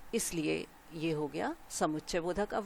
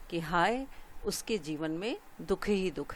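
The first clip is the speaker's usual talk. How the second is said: usual speaking voice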